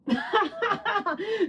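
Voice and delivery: laughing at her own Regina George joke; high laugh. Real, not acted. She cracks up at the Regina George image, high laugh. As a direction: burst of high-pitched laughter.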